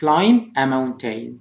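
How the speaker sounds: slow "Climb" ending on M, no B; silent B. Shown as a demonstration. Teacher modelling "Climb" in its phrase, B silent. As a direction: say "Climb" slowly as "clime". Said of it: "حرف البي silent".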